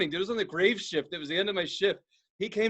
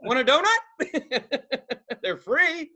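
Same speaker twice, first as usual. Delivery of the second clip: high, through laughter; joking offer; 6 semitones higher, much slower than usual. Pretend. Teasing offer to the upset coworker, laughing. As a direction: crack up while offering.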